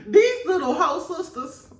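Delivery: high, laughing through the words; giggling repeat. Real, not acted. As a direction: crack up repeating it. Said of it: "repeated laughing".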